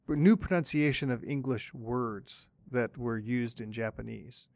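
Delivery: ordinary talk, nothing marked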